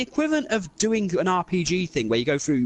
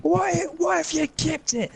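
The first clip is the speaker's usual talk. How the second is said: higher Scottish voice, mocking and scolding; Scottish rant; 10 semitones higher than usual. Scottish character mocking the ring bearer's selfishness, higher voice. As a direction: berate the selfish hobbit in a Scot's voice. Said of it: "first time I've used the accent".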